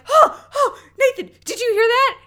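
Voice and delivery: high, loud, breathless-with-glee reenactment; excited retelling. Retold or imitated. Co-host reenacting her triumph to Nathan, excitedly high and loud. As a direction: squeal it as if you just nailed something. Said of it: "I was so excited".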